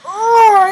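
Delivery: high, loud mock groan of effort; strongman's hammed-up heaving cry; straining roar. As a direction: roar as if pushing over pillars. Samson strains at pillars, acted.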